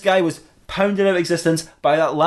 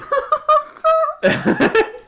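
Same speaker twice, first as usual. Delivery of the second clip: helpless high laugh; high squealing laugh. Real, not acted. Laughing at own joke.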